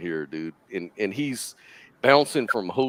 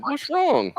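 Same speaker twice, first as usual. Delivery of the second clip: much higher mock worried question; pitched-up imitation of a puzzled wife; imitating his wife. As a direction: ask it high and innocent. Wife asks why pool emptied.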